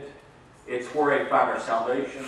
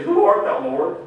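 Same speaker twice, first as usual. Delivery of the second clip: much higher, louder, startled cry; frightened cry; 7 semitones higher, 7 dB louder than usual. Acted. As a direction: cry out in shock to the voice. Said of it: "but he's gonna say".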